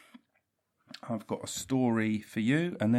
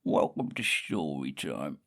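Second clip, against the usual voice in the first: silly low voice; 6 semitones lower than usual. Shown as a demonstration. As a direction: lower silly storyteller voice with lisp on story. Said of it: "I quite like that voice".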